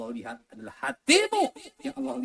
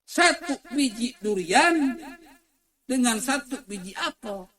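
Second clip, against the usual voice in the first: raised, high-pitched question to the crowd; loud preaching call; 11 semitones higher, 6 dB louder than usual. Real, not acted. Preacher asks the crowd to compare prices, loud and high. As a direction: call it out loudly to the congregation.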